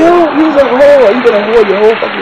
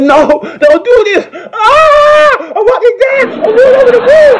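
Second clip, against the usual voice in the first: much higher, crying shout, slowed at first; hammed-up sobbing plea; 3 dB louder, slower than usual. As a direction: wail like a dumped lover, overplayed.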